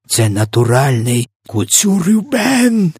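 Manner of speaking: high, drawn-out shout; hammed-up yell. A narrator's reading. Giant names the awful dream, slow high yell. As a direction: build to a stretched high cry. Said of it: "заволав В Д В".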